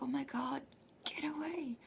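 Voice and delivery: high, squeaky retold panic; replaying the moment she spotted the bug; mock panic. Recalling the cockroach flying out, she squeaks in mock panic.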